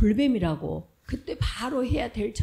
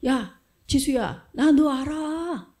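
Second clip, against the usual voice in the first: Jesus calling out; 4 semitones higher, 6 dB louder than usual. Acted. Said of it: "예수님께서".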